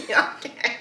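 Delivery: high, real laughter breaking up the words; laughing hard. Real, not acted. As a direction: crack up and talk through it. Cracks up over the tourist remark.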